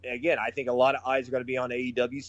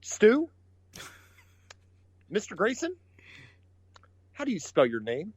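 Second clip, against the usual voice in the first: mock irritated; 5 semitones higher than usual. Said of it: "and just goes".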